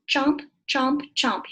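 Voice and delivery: loud, punchy chomp chomp chomp; chomping sound. Shown as a demonstration. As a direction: bite the sound out loud like eating. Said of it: "Your turn".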